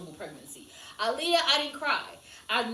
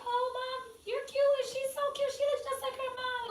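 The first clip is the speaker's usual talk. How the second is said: high, sweet gushing voice; mock a stranger fussing over a baby; 13 semitones higher than usual. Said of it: "people stop us all the time and say".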